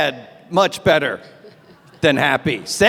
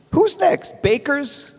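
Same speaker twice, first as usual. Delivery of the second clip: Who's next? asked much higher in mock alarm; alarmed, rising; 6 semitones higher, slower than usual. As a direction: ramp up the panic on the repeat. Repeated question escalated.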